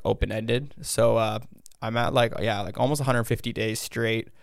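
ordinary talk, nothing marked

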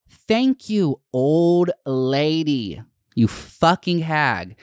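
high mock-sweet "Thank you", then slow, drawn-out insults; sarcastic sweetness curdling into contempt; snide fake thanks. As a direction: pretend comeback, syrupy then cutting. Imagined retort to rude clerk.

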